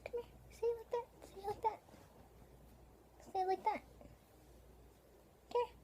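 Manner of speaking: put-on high, squeaky baby talk to the cat; high cutesy voice telling the cat to stay; cute pet voice. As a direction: coax the cat sweetly, way up high. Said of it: "I'll do it in a cute way".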